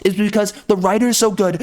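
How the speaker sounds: normal talking voice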